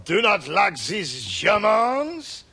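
slow, hammed-up French accent; put-on French accent. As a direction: declaim it in an overdone French accent. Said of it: "putting on a French accent".

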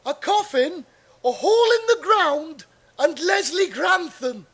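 bright TV presenter welcoming the audience, pitched high; Paul Daniels impression. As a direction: showman host on a Saturday night quiz. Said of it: "I'm Paul Daniels".

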